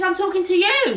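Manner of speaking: loud, high, confrontational retort; imitated shouting. Shouting woman singling her out, imitated confrontation. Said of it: "she was just shouting".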